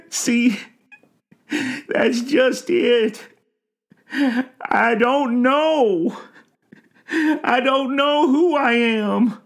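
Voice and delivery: slow, high, loud wailing; old man crying in helpless despair; sobbing. A narrator's reading. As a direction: bawl it out between sobs. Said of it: "The old man sobbed".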